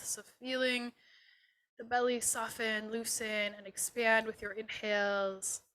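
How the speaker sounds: unhurried and settling, guide them gently inward; guided meditation. Acted. Yoga teacher leading students through a body scan, guided meditation.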